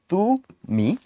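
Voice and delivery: "তু" and "মি" as two separate beats; syllable by syllable. Shown as a demonstration. Teacher counting syllables, "তু" and "মি" said separately. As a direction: break "তুমি" into detached syllables.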